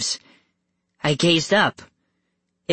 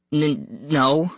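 stammering. Acted. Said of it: "I stammered".

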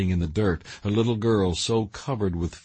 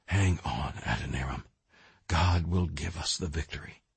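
faint, fading last words; barely able to speak; weak dying whisper; 4 semitones lower, 6 dB quieter than usual. A narrator's reading. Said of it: "She struggled to speak".

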